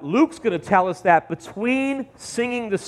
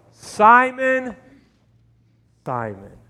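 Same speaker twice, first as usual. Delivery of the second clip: solemn calling out; 3 semitones higher, much slower than usual. Acted. Jesus calling Peter.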